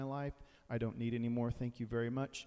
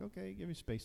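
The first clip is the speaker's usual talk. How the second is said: mild brush-off; 6 semitones higher, 7 dB quieter than usual. Pretend. Pushing the dog away.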